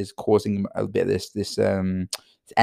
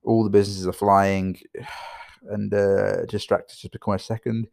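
normal talking voice

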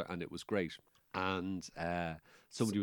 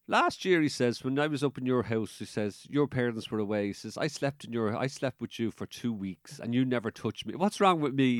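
mock complaint; 5 semitones higher, 8 dB louder than usual.

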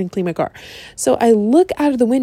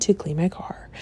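whispered aside; 4 semitones lower, 10 dB quieter than usual. Real, not acted. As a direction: whisper the words as a private aside. Aside after retelling line.

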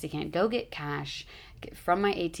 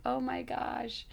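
dismay; 7 semitones higher, 4 dB quieter, slower than usual. Retold or imitated. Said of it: "I'm like".

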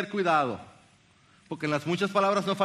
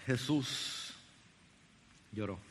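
much softer, slow and solemn; a softer, reverent reading; hushed reverence; 5 semitones lower, 9 dB quieter, much slower than usual. Acted. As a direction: let the verse fall quietly. Solemn reading of shortest verse.

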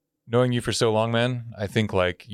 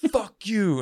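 retold taunt; 10 semitones higher, slower than usual. Friend jeering at him walking home, mock taunt. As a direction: call it out high, imitating a jeering heckler. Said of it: "and he's laughing".

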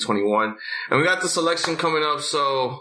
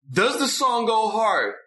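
hyped; 8 semitones higher than usual. Acted. Hyped rhetorical question.

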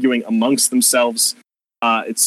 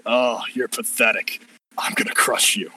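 menacing villain; 2 semitones lower than usual. Acted. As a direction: sneer and promise to crush. Villain threatens.

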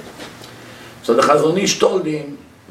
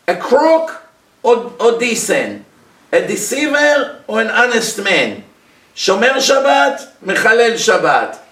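rising preacher fervour; 5 semitones higher, slower than usual. Acted. Rabbi lists traits to judge people.

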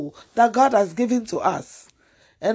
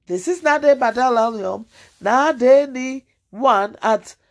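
usual speaking voice

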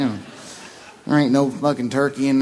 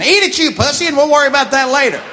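high, loud laughing jeer; bully your buddy into it, laughing; drunken buddies shouting and laughing; rowdy heckle; 10 semitones higher, 5 dB louder than usual. Retold or imitated. Drunk guys heckling Barry.

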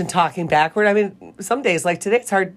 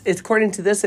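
ordinary talk, nothing marked

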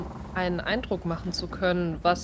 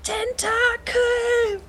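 high drawn-out alarm cry; shouting; 14 semitones higher, 4 dB louder, much slower than usual. Acted. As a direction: cry out the warning slowly. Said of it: "ruft Saraswati".

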